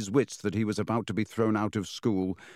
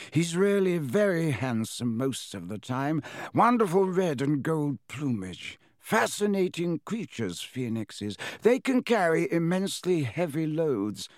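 higher, lilting voice of a fond headmaster; Dumbledore; 6 semitones higher than usual. A narrator's reading. Headmaster praises his phoenix to Harry, fondly lilting. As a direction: praise the bird with rising, admiring emphasis.

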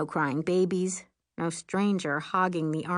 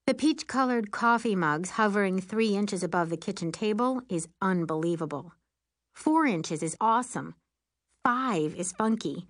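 usual speaking voice

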